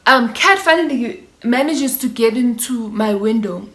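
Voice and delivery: ordinary delivery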